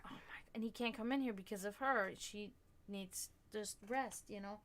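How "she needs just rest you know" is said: very soft and slow; hushed. Real, not acted. Explaining she needs rest, very soft and slow. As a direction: drop to a near hush. Someone resting nearby.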